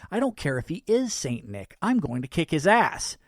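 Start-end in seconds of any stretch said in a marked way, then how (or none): none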